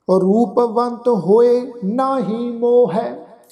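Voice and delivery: slow, devout chanting, each phrase drawn out; gurbani recitation. Acted. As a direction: chant the verse with reverence.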